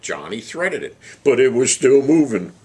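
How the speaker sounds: southern yarn building to a shouted punchline; southern accent. Retold or imitated. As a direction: imitate a southerner, then belt out the punchline high. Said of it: "he says in his southern accent".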